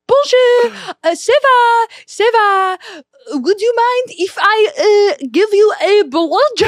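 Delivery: high, coy mock-French; French accent. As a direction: flirt in a hammed-up French accent.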